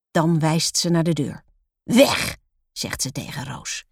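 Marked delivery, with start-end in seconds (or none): 1.8-2.4 raised, curt command; stern dismissal. A narrator's reading. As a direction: order the pupil out at once. Teacher sends pupil out.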